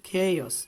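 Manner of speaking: emphatic corrective "chaos"; correct pronunciation. Shown as a demonstration. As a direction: bring "chaos" back firmly as KEI-os. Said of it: "not chaos".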